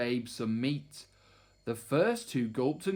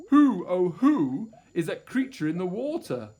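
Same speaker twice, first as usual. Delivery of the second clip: wondering question; 3 semitones higher, 6 dB louder than usual. A narrator's reading. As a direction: marvel at the stranger in the water. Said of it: "he asked them".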